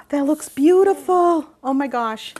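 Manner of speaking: gasp, then loud, high, drawn-out praise; delighted amazement. Real, not acted. Gushes over granddaughter's sprinkles.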